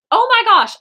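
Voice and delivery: high, loud burst of exasperation; exasperated outburst. Real, not acted. Artist catches own verbal habit, exasperated outburst. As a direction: burst out, fed up with yourself.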